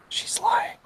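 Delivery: breathless whisper in the ear. A narrator's reading. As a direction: lean in and whisper a secret warning. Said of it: "pulls you down to whisper in your ear".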